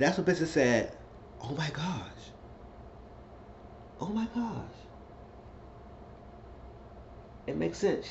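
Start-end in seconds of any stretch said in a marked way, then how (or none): 1.4-8.1 soft and high, stunned; dawning shock, hushed; stunned realization. Retold or imitated. Benson realizes the twist.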